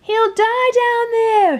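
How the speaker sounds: high, loud, slow alarmed shout; boy shouting in alarm. A narrator's reading. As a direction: yell in panic for a friend. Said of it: "shouted James".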